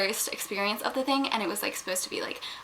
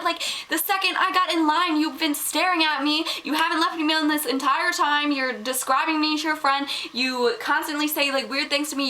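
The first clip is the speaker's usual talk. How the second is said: high-pitched, exasperated rant; retold angry yelling; 6 semitones higher than usual. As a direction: act out freaking out on someone. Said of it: "yelled at him ... freaked out on him".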